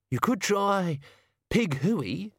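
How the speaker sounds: Pig Hooey offered with a questioning rise; tentative suggestion. A narrator's reading. Servant tentatively suggests a call.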